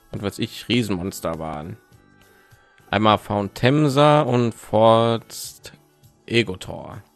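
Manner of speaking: ordinary talk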